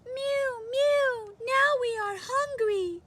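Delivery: high, squeaky kitten voice; mewing cats. Acted. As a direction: mew like a hungry kitten. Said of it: "said the hundreds of cats".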